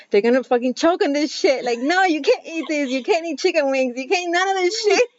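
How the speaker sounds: retold frantic no-you-can't; paranoid outburst. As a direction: play up the panicky mother saying no. Retold self-quote, paranoid mom warning kids.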